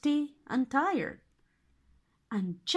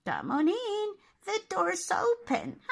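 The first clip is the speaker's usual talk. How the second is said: high cackling witch voice; 9 semitones higher than usual. A narrator's reading. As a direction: sweet-talk them in like a witch. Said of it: "the witch said".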